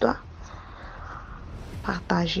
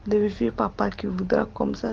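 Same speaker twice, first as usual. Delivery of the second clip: usual speaking voice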